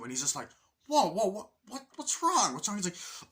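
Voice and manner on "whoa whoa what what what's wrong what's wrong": high, loud alarmed questioning; startled reaction. Retold or imitated. Roommate startled by sudden outburst, high alarmed questions. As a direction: blurt it out, taken aback.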